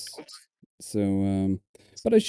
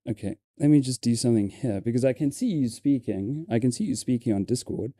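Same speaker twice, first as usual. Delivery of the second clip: ordinary talk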